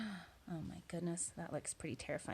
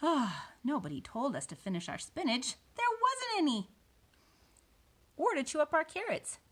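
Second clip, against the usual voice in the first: animated, raised-pitch storybook narration; reading to children; 6 semitones higher than usual. A narrator's reading. Boy describes pirate table manners, animated narration. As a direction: read it with delighted emphasis. Reads picture book aloud.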